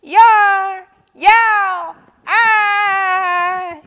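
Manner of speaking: high, loud drawn-out yowls; three long high yells; tantrum yells. A narrator's reading. Yak joins the fake tantrum, yelling. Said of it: "yelled Yak".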